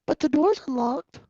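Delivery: high-pitched, exasperated arguing; incredulous protest. Acted. Younger self objecting outside closed Burger King, incredulous. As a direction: argue it high in disbelief.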